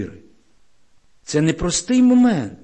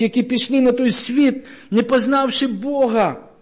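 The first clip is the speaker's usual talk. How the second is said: rising fervour; 7 semitones higher, 4 dB louder than usual. Real, not acted. Preacher builds to climax.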